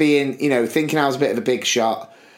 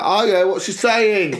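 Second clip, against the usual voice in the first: teasing interest; 5 semitones higher than usual. Retold or imitated. Imitates mate's eager, teasing reply.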